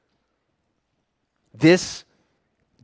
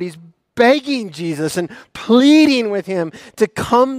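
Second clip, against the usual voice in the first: raised, intense retelling of the father's plea; dramatic storytelling; 5 semitones higher, 7 dB louder than usual. Acted. Preacher painting the father's plea for the congregation, intense. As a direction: paint the desperate scene with rising urgency.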